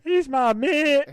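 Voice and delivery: high, drawn-out mock Scottish shout; Scottish accent. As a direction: wail it in hammed-up Scottish. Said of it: "your Scottish accent is shit".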